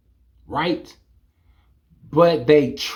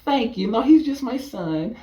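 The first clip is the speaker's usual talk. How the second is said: flattered mom; 8 semitones higher, 3 dB quieter than usual. Retold or imitated. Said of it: "mom's flattered reply".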